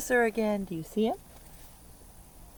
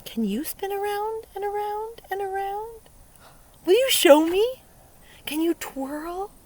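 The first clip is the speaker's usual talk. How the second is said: much higher, playful coaxing; high sing-song; 13 semitones higher, 6 dB louder than usual. Acted. Teacher coaxing kids to spin, high sing-song. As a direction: lift it up like a game. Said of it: "asks children to spin along".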